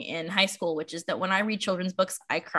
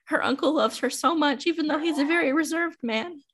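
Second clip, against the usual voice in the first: high, choked-up, moved voice; teary adult reader; 8 semitones higher than usual. Retold or imitated. Reading her childhood book as an adult, high choked-up voice. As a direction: let it well up as if near tears. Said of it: "I'm like".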